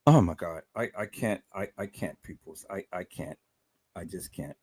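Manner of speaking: shaken, barely getting the words out; overwhelmed. Real, not acted. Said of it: "way, way too emotional".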